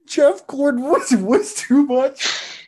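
words squeezed out through laughter; high, slow, breaking into giggles; laughing. Real, not acted. Cracking up mid-sentence.

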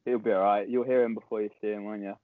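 ordinary talk, nothing marked

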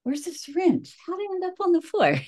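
playing her dazed self, fast; confused. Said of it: "I'd be like".

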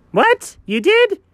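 very high, startled squeak of disbelief; shocked. Pretend. Friend hears the toys are already chewed, high shocked squeal. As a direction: shoot the pitch right up in disbelief.

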